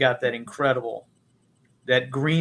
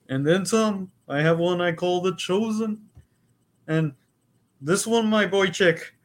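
higher, hammed-up Yiddish-inflected accent; 5 semitones higher than usual. Shown as a demonstration.

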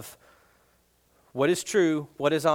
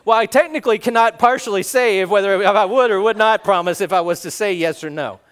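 teasing evasion; 4 semitones higher, 8 dB louder than usual. Retold or imitated. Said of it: "And I'll go".

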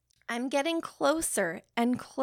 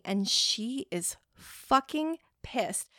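normal talking voice

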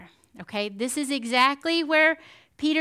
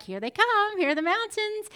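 mock excitement; 7 semitones higher than usual. Excited at mountains appearing.

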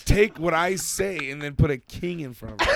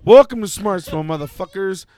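loud mock intro; 3 semitones higher, 8 dB louder than usual. Friend taking a turn at the podcast intro, loud host welcome. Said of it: "let me have a shot".

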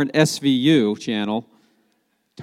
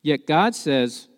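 ordinary talk

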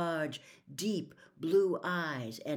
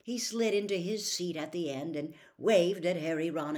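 usual speaking voice

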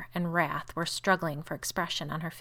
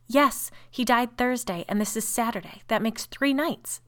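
rigid; 6 semitones higher, 5 dB louder than usual. A narrator's reading. Sister confirms the nights since the death, rigidly. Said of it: "said Caroline rigidly".

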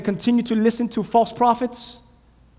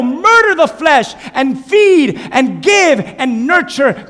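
higher, louder, slow commanding shout; thundering rebuke; 6 semitones higher, 6 dB louder than usual. Acted. God thunders at Jeremiah to feed the spirit, loud commanding rebuke. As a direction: pound it in like a stern preacher.

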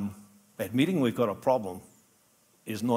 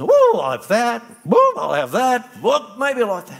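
a hammed-up shopper swept away, much higher and louder; giddy impulse shopper; 11 semitones higher, 12 dB louder than usual. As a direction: play a shopper grabbing everything in sight.

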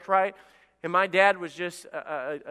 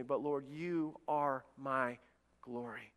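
slow, soft, lowered confession; hushed reverence; 4 semitones lower, 11 dB quieter, slower than usual. Acted. David left with nothing confessing to the Lord, hushed and reverent. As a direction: let it sink slowly to near silence.

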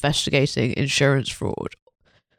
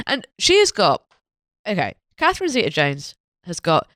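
normal talking voice

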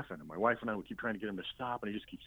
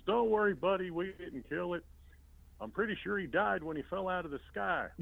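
higher, mock-soothing voice; reassuring grandpa; 10 semitones higher than usual. Grandpa consoling the crying boy in the car, higher and mock soothing. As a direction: pretend to comfort the kid.

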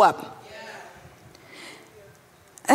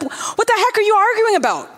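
a higher, indignant "What the heck"; exasperated Jesus; 6 semitones higher than usual. Retold or imitated. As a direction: scold the bickering crowd. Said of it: "Jesus came and was like".

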